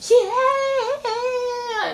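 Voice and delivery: high, long, wailing yeah; mock caterwaul. Mocking singers who moan, high wailing yeah. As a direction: wail it like an overblown singer. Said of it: "moan and caterwaul and go".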